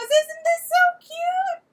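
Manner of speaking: high, loud, slow gushing squeal; gushing quote. Retold or imitated. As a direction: squeal it out like a delighted sister. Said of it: "she hands it to me and goes".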